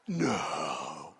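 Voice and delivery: very low, drawn-out mock disbelief; jerky sarcasm. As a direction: drop deep and stretch it sarcastically. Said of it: "that's the flesh jerky part of me".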